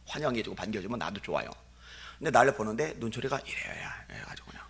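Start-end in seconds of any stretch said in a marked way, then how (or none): 3.0-4.7 hushed aside. Real, not acted. Describing a glare, whispered.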